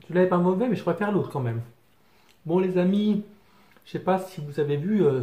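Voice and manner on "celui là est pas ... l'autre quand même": talking while chewing food; muffled by mouthful of sandwich; mouth full, chewing. Real, not acted. Seen 2: Bites sandwich at start, then talks with chewing, lips half closed.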